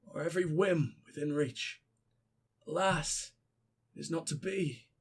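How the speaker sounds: whispery ghost reading a farewell letter; dead sounding voice. Pretend. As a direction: read the letter like a voice from the grave. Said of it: "I can make him dead sounding".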